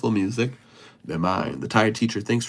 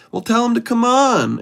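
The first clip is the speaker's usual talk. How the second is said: much higher and louder, eager invitation; excited coaxing; 12 semitones higher, 6 dB louder than usual. Real, not acted. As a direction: call him in with big excitement. Coaxing shy fan to join.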